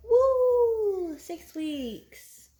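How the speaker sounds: high-pitched woo, falling away; real excited squeal of celebration; celebratory cheer. Real, not acted. Celebrating the six-week milestone.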